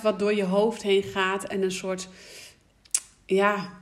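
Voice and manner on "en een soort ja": usual speaking voice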